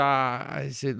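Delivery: normal talking voice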